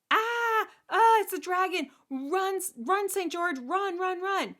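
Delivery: very high, loud shriek of alarm; storybook princess screaming in terror; panicked scream. Acted. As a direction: scream a frantic warning to flee. Princess sees dragon, cries warning.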